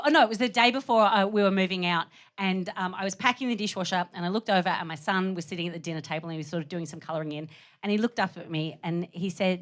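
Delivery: usual speaking voice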